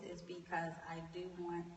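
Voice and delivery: quiet, level, soothing tone; soft monotone voice. Real, not acted. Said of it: "talking in a soft, monotone voice".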